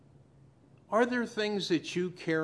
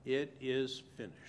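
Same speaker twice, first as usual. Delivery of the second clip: softer, hushed repeat of the words; quiet echo; 3 semitones lower, 7 dB quieter than usual. Shown as a demonstration. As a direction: let it sink in, hushed. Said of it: "when he said".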